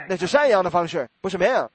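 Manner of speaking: normal talking voice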